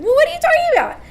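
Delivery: high, loud, frantic disbelief; going mad. Retold or imitated. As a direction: act out a panicked girl losing it. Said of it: "a girl who was going mad".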